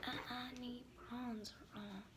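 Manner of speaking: hushed and slow; slow and soft. Acted. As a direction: ease it out softly. Repeats the line in a new way.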